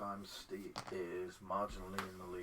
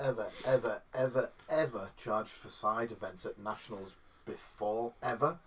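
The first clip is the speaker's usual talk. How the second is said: each EVER punched louder; emphatic repetition; 6 dB louder than usual. Real, not acted. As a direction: hammer every "ever" with force. Insisting it has never happened.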